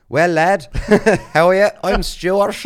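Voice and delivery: hammed-up Irish accent, laughing through it; Tullamore Irish accent. Imitating the Irish lad at the gym in a loud, laughing Irish accent. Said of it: "I'm not doing the Tullamore accent any service".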